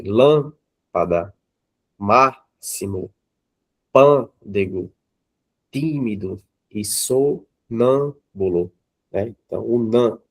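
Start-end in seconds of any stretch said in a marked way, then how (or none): 0.0-8.7 "Lâmpada, máximo, pândego, tímido e sonâmbulo" very slow, holding each accented syllable; stressed syllables stretched. Shown as a demonstration. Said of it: "mais forte". (de "Lâmpada" a "sonâmbulo", devagar, alongando a sílaba forte)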